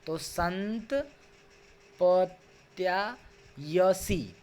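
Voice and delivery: very slow, each syllable of "संत पत्यायसी" drawn out separately. Shown as a demonstration. Teacher to online class, spelling out a word very slowly. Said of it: "दोबारा से पढ़ूँगा लय के साथ".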